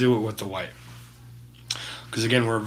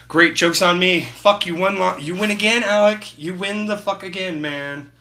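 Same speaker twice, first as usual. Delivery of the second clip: raised, louder mock frustration at being beaten; mock exasperation; 6 semitones higher, 6 dB louder than usual. After smudging wet paint, mock-grumbles at viewer Alec, louder and raised. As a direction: throw up your hands, pretending you lost a game.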